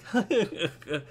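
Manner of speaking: put-on high-pitched little boy giggle; child's giggle. Boy laughing at the elf, high childish giggle. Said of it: "the boy stops laughing".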